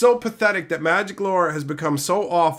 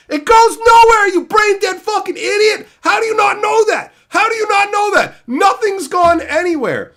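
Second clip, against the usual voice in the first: very high, very loud enraged shout; furious scream; 10 dB louder than usual. Real, not acted. Raging at a fan who expects the story to go somewhere, furious scream. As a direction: scream it at the idiot.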